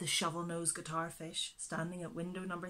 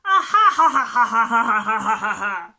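loud laughter; 3 semitones higher, 18 dB louder than usual. A narrator's reading. The animals laughing at the wasp, loud high laughter. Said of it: "laughed all the other animals".

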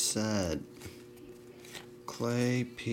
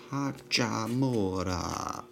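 slow, drawn-out Italian-accent Hachimura; light mock Italian accent; slower than usual. Shown as a demonstration. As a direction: say Hachimura the Italian way, stretching every vowel. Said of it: "That's if you say it in an Italian accent".

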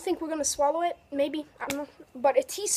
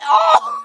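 coughing yelp; 7 dB louder than usual. Real, not acted.

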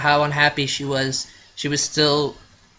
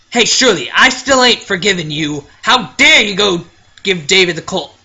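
loud, higher, furious telling-off; angry scolding; 4 semitones higher, 9 dB louder than usual. Acted. Friend scolding Shirley over the Colt, loud and angry. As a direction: chew her out hard.

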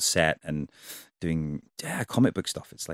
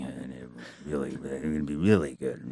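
mumbled Vin impression; 5 semitones lower, 5 dB quieter than usual. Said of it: "had no idea what he was saying".

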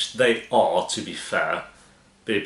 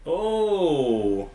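long, high "ohh" that slides down; higher, falling exclamation of reaction; drawn-out "oh"; 8 semitones higher than usual. Real, not acted. Viewer spotting coppers on screen, drawn-out falling "oh".

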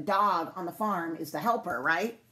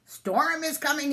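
announcing; 7 semitones higher than usual. A narrator's reading. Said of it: "the animals told her at once".

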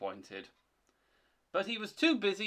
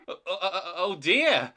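higher, stammered "oh" building louder; alarmed exclamation; 4 semitones higher, 7 dB louder than usual. A narrator's reading. Thomas hears the ghost, exclaiming in fright. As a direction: stutter into a frightened cry. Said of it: "exclaimed Thomas".

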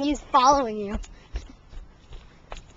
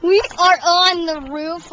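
announcer voice; 5 semitones higher, 8 dB louder than usual. Acted. Kid starts the vlog, announcing.

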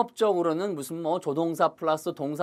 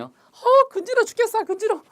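much higher, loud squeal of itchiness; itchy and frantic; 7 dB louder than usual. Pretend. Teacher acting out an unbearable itch, high squealing voice. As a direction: squeal like the itch is driving you mad.

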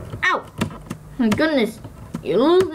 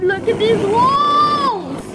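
higher, slow, stretched-out amazement; amazed; 8 semitones higher, slower than usual. Real, not acted. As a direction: drag out walls in awe. Repeats, drawn out and high.

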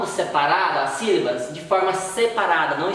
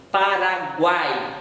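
"Paraguai" spoken very slowly, split into its syllables; slow "Paraguai" down, each syllable on its own; much slower than usual. Shown as a demonstration. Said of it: "você separou a sílaba".

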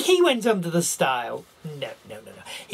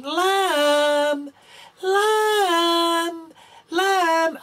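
high, sing-song drawn-out call; 10 semitones higher, 4 dB louder, much slower than usual. Acted. Shepherd calling for the lost lamb, sing-song. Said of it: "the shepherd kept on calling".

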